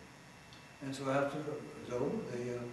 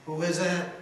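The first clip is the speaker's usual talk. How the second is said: loud booming call, pitched higher; boomed; 4 semitones higher, 7 dB louder than usual. Retold or imitated. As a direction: call out loudly from behind the door. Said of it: "this voice boomed out".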